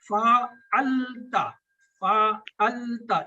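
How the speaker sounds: फ़अलता drawn out very slowly, each syllable fa-al-ta clear; careful pronunciation. Shown as a demonstration. Teacher modelling फ़अलता slowly for students. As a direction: spell out फ़अलता slowly for the class. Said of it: "ये तलफ़्फ़ुज़ की वजह से".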